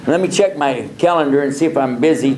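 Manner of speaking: ordinary talk, nothing marked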